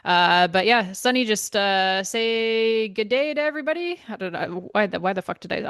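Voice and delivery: normal talking voice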